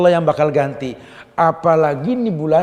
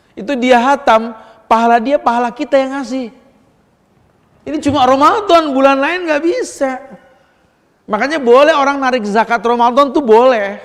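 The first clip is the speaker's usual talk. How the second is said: high, loud preacher's push; preaching at full force; 9 semitones higher, 9 dB louder than usual. Acted. Preacher urging the congregation to give in Ramadan, loud and high. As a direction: drive the point home to the congregation.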